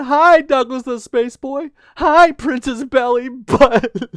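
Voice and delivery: high, loud blubbering greeting; hammed-up weepy creature voice; crying little lizard. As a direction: wail hello through mock sobs. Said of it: "the sound of the crying".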